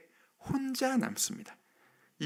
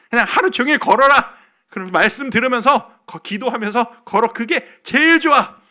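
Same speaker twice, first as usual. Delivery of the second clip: lightly imitated senior pastor, louder and insistent; 이찬수's emphatic counsel; 7 semitones higher, 9 dB louder than usual. Senior pastor urging a struggling younger pastor to walk, emphatic imitated advice. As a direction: urge it firmly, building in pitch and volume. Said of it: "그때 저에게 해주셨던 말씀 중에 하나가".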